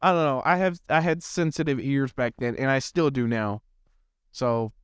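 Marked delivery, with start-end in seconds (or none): none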